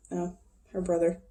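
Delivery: ordinary delivery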